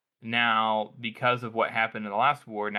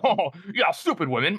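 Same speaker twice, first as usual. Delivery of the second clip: high, loud jeering put-on voice; hammed-up scoffing officer; mock sexist officer; 7 semitones higher, 4 dB louder than usual. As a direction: jeer along like a chortling crony. Acts officers jeering at the lady.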